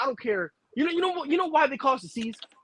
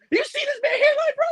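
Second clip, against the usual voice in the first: mock outrage. Mocking a rival's hairline.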